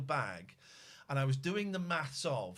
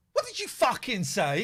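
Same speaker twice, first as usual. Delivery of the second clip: angry; 5 semitones higher, 7 dB louder than usual. Retold or imitated. Aggressive man retorting.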